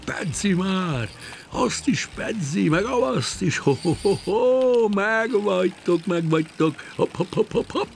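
high, triumphant whoops with hooting laughter; whooping and ho-hoing. Acted. Fisherman yanking his rods one by one, excited high whooping. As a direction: crow wildly over every bite. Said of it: "rikkantva és hohózva".

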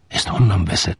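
usual speaking voice

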